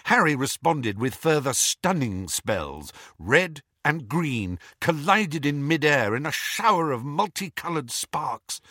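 usual speaking voice

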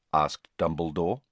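plain reading voice

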